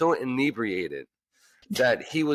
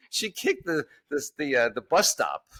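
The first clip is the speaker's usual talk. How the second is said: ordinary talk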